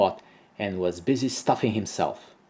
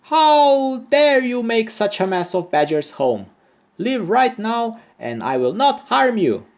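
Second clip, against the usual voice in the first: high, loud furious shout; shouting ant; 11 semitones higher, 4 dB louder than usual. A narrator's reading. Ant ordering the goat out of Badger's home, loud high shout. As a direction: yell as big as a tiny ant can. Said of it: "shouted as loud as he could".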